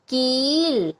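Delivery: कील with a drawn-out ee; long vowel. Shown as a demonstration. Teacher building a word with the big ee sign, long vowel. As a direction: stretch the ee in कील before the l. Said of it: "इसका उच्चारण थोड़ा लंबा".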